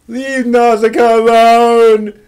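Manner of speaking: mock outraged shout. Streamer yelling at chat to leave Nausicaa alone, mock outraged shout. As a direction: bellow it like a fan defending a classic. Mock protest at remake idea.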